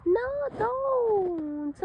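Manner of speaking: a small character begging no; high squeaky plea. Acted. Sammy pleads with the others in a high voice.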